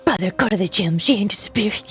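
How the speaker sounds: low, rough whispered voice; silly scolding voice. Acted. Streamer telling a viewer to go to gym, silly scolding voice. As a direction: growl it low at a slacking brother.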